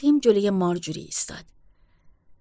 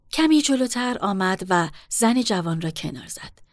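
plain reading voice

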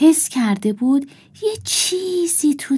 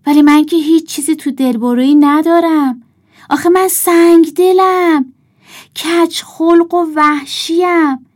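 loud, higher-pitched boastful wolf voice; wolf character; 7 semitones higher, 7 dB louder than usual. A narrator's reading. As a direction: brag loudly as the nasty wolf. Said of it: "گرگ گفت".

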